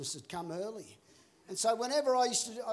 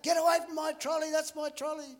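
high, loud mock shout at a trolley thief; imagined yell; 8 semitones higher, 5 dB louder than usual. Imagined owner yelling at the old lady, high loud mock shout. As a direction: bellow it like an angry owner.

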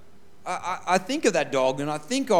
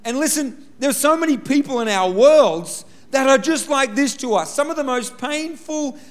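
rising preacher's build, higher and louder; preaching; 7 semitones higher, 3 dB louder than usual. Acted. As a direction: drive the lesson home to the congregation. Preaching application.

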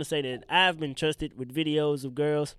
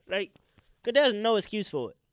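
ordinary delivery

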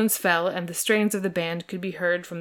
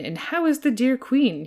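reverently; 7 semitones higher, 3 dB louder than usual. A narrator's reading. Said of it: "he said reverently".

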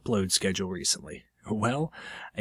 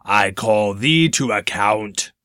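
knightly challenge; 6 dB louder, slower than usual. Acted.

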